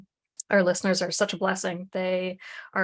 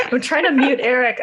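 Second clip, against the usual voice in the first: laughing loudly through the words; real laughter while fumbling for the mute button; 7 semitones higher, 9 dB louder than usual. Real, not acted. Co-host laughing while trying to mute a guest.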